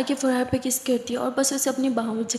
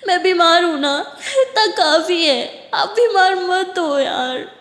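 crying apology. Acted. Siya sobbing as she begs Tara not to leave, tearful. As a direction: beg him not to leave through sobs. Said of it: "इतनी बुरी तरह रोते हुए".

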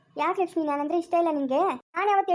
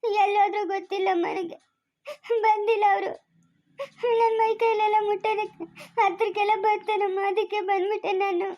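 tearful, higher voice; crying; 4 semitones higher than usual. Acted. Lakshmi crying to her mother-in-law about the harassment. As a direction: weep while explaining. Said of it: "ಅಳೋದ್ ನಿಲ್ಸಮ್ಮ".

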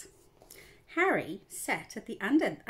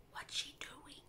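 breathy unvoiced whisper, very soft; 15 dB quieter than usual. A narrator's reading. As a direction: whisper it so no one hears. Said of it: "whispered Harry".

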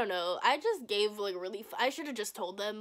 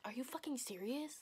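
hushed retold scolding; soft, imitated mom's reproach; quietly going off; 3 semitones higher, 10 dB quieter than usual. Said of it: "quiet enough so my dad wouldn't hear".

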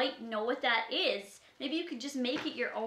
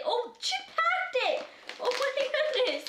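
high, thrilled gasp of words; excited squeal; 11 semitones higher than usual. Real, not acted. Finding a surprise tucked inside the gift, high excited squeal.

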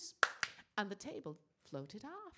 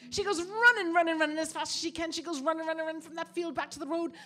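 higher and louder, racing along; breathless chase narration; 6 semitones higher, 13 dB louder than usual. A narrator's reading. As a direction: narrate the escape at full tilt. Narrating frantic escape.

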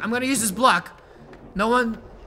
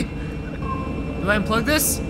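ordinary talk, nothing marked

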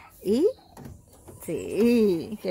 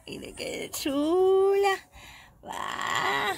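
higher, very slow pet voice; fond cooing; 8 semitones higher, 3 dB quieter, much slower than usual. Real, not acted. Owner cooing over the goat's belly, slow and high. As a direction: coo over how cute she is.

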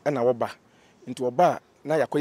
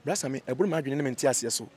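ordinary talk, nothing marked

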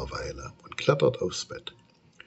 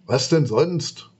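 slow, louder sulky retort; a lightly voiced grumpy monster; grumpy rumble; 5 semitones higher, 5 dB louder, slower than usual. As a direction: monster answers Moritz sulkily. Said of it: "brummt das Monster missmutig".

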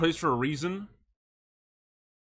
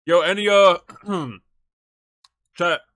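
louder, higher call to chat; 3 semitones higher, 10 dB louder than usual. Real, not acted. Streamer calling out to chat, loud. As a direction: get chat's attention.

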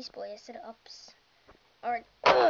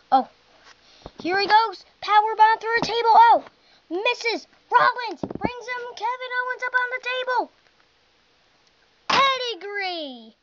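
higher, fast ringside call; excited play-by-play; 9 semitones higher, 6 dB louder than usual. Acted. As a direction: call the big finish like a hyped announcer.

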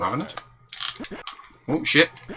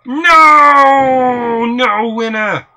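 long, loud, high drawn-out cry; dramatic wail; 7 semitones higher, 7 dB louder, much slower than usual. Pretend. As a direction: wail it out in mock despair. Dramatic cry at losing round.

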